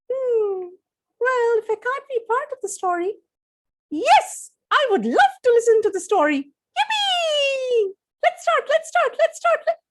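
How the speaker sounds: high, bouncy little giraffe; high squeaky giraffe, delighted; excited baby giraffe. Acted. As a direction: cheer like an eager toddler animal.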